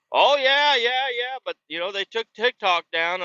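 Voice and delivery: put-on high, eager old-timer; squeaky country geezer agreeing; old man voice. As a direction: chime in like the excited old man. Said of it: "He's like".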